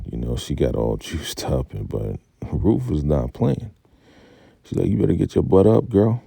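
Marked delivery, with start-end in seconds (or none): 4.9-6.2 loud, fast scold; scolding order. Retold or imitated. Ruth ordering Melanie out of bed, loud and scolding. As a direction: snap her out of bed.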